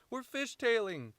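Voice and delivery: high, loud, stretched cry; alarmed yell. Acted. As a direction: yell a warning as the car skids. Acted quarrel in skidding car.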